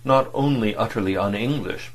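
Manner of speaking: ordinary delivery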